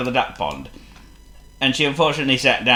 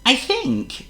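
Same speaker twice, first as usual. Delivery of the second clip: high, light mouse-mother voice; much higher motherly mouse voice; Missus Mouse. A narrator's reading. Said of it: "said Missus Mouse".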